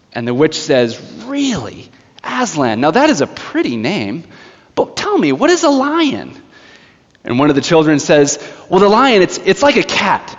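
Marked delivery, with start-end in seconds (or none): 1.3-6.4 high, sugary mock curiosity; mocking witch. Witch asking children about Aslan, high mocking sweetness. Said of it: "There she is, just mocking them". 8.7-10.3 put-on earnest child explaining. Child explaining a lion to the witch, eager and higher.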